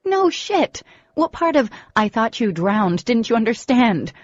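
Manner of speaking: loud, high indignant snap; exasperated outburst. A narrator's reading. Furious girl retorting to the teasing boy, loud. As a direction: fire it back at him.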